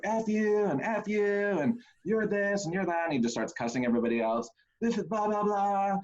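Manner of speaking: put-on high, belligerent drunk voice; mock drunk belligerence; drunk best man. Drunk best man cussing everyone.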